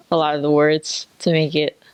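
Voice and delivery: usual speaking voice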